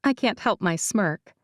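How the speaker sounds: ordinary delivery, nothing marked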